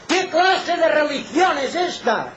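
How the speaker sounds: high, ringing rhetorical question; high-pitched pulpit outcry; impassioned preaching. Real, not acted. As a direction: fling the question at the congregation. Preacher at full force.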